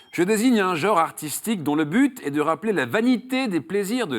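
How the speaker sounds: high, loud-edged game-show delivery, falling away on the last option; theatrical quiz question read. Acted. Host riddles a contestant on an art genre, theatrical quiz question read. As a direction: declaim the riddle, then list the choices.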